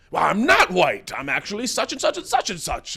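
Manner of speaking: retold yelling. Imitating a man yelling about his race, mock indignant. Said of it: "He was yelling about".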